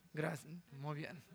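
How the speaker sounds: ordinary delivery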